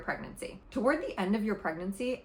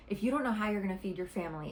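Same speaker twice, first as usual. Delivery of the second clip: usual speaking voice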